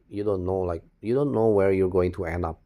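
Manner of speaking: ordinary talk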